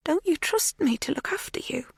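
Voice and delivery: high, whispery question; breathy high-pitched coaxing; wheedling. A narrator's reading. Magda presses captive Kate.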